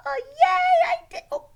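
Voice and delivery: very high, loud squeal of delight; excited cheer. Acted. Child praised by the teacher, cheering excitedly. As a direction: burst out in a high, gleeful kid cheer.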